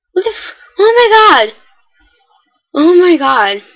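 high, loud frightened scream with a cry; terrified, let the voice crack high; panicked scream. Real, not acted. Kid screams as mobs attack him in the game, panicked.

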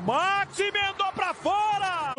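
near-miss exclamation. Real, not acted. Shot goes wide.

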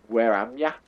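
home-region English dialect; regional country accent. Shown as a demonstration. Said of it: "my accent come through".

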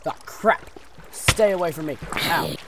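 loud panicked combat yells; yelling. Real, not acted. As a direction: shout while fighting. Fighting off mobs.

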